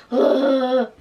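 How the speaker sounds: loud awed groan of delight; mock ugh. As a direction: let out an overwhelmed ugh. Overwhelmed by the big song.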